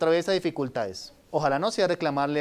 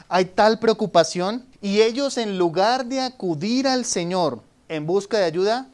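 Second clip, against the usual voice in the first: usual speaking voice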